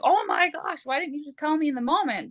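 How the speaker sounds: high-pitched mock dismay; imitating exasperated disbelief. Partner blindsided by sudden pile of complaints, mock exasperation. Acted reaction to the outburst.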